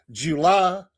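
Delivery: "July" stretched slowly, "Joo-lah"; drawn-out July. Shown as a demonstration. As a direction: linger on both syllables of "July". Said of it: "Say July one more time, please".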